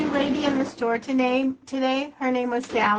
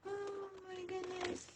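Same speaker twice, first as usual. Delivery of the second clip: soft gasp; 8 semitones higher, 17 dB quieter than usual. Real, not acted. Gasps at sewing mishap.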